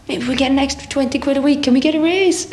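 high, rising mock plea; pleading band request. Quoting the young band's request.

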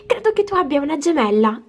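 ordinary talk, nothing marked

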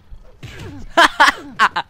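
loud high burst of laughter. Real, not acted. Gamer laughing at the prison fight, loud high laugh.

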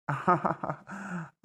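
short, soft mocking laugh; gloating little chuckle. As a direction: laugh off his plea. Captors mock the tied man.

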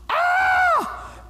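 high startled mock yell; mock scream. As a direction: let out a comic shriek. Mummy Lazarus comes out.

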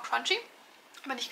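talking between bites; chewing while talking. Real, not acted. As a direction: mouth partly full of food. Seen 1: Bites bean at -2, chews and talks with food until about 3s.

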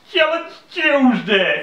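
slow, drawn-out announcer boom; dramatic announcer voice. Shown as a demonstration. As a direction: announce it like a game show title. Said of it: "If you say it like that".

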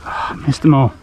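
ordinary talk, nothing marked

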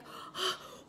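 quick shocked intake of breath, unvoiced; sharp gasp of pain. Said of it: "and I was like".

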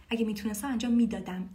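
usual speaking voice

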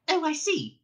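high, quick interrupting mouse; Frankie mouse voice. A narrator's reading. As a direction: jump in impatiently. Frankie realises.

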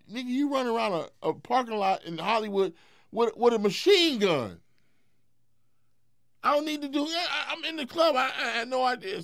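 high, loud indignant retort. Retold or imitated. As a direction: shout back in disbelief. Indignant reply in story.

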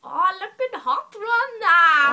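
high, loud, slow diva squeal. Pretend. Sister hams up the diva voice for the camera, high and loud. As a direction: drawl it out like a gushing socialite.

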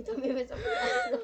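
high loud scared cry; scared shout. Real, not acted. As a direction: cry out in fear. Monster staring at them.

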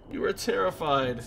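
ordinary talk

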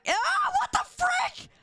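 high-pitched panicked outburst; startled shout. Real, not acted. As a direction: shriek it in shocked disbelief. Bot turns on him, startled.

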